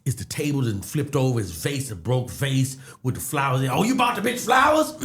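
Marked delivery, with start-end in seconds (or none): 3.7-4.9 jealous accusation. Retold or imitated. Imitating the ex accusing him about the flowers.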